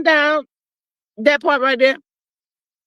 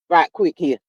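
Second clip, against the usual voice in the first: ordinary talk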